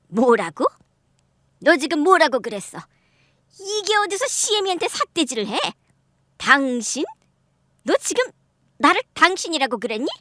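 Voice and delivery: an offended elder demanding how dare she; outraged mother-in-law. Acted.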